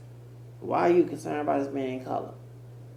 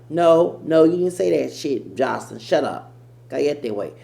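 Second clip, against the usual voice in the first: mock scolding; 4 semitones higher, 9 dB louder than usual. Scolding the woman he imitated.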